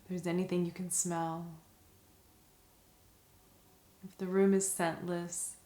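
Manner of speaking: soft, calm meditation voice with long pauses; guided meditation. Acted. Teacher guiding listeners through a five senses meditation, soft and calm.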